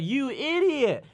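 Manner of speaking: high-pitched, drawn-out mock outrage; exasperated retort. Comedian retelling his comeback to her, exasperated. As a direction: stretch it out high in disbelief. Acted comeback in retold bedroom story.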